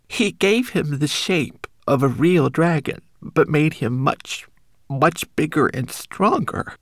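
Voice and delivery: high, small homunculus voice; a tiny frightened creature warning. Acted.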